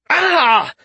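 sudden high loud yelp of pain; pained cry. A narrator's reading. Malseus feels his face burn, high loud yelp. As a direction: cry out as a sharp sting hits. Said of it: "suddenly winced".

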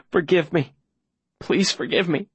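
beg, dropping down from the shouting; anguished pleading. Acted. Boy about to give up the secret, tearful plea. Begs forgiveness before betraying secret.

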